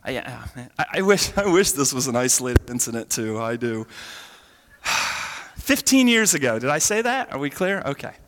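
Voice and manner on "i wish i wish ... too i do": ordinary talk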